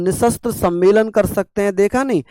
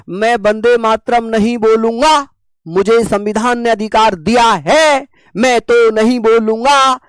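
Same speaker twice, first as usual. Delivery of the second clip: mock defiant refusal; 3 semitones higher, 4 dB louder than usual. Teacher mimicking people who refuse to say Vande Mataram, mock defiant. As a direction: mimic someone loudly insisting on their rights. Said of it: "कुछ लोग हैं ऐसे".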